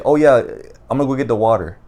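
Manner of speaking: hard D in "the" before "water"; "the" as "duh". Shown as a demonstration. Showing a friend's habit slipping out, "the" said "duh". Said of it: "I'll say ... instead of the".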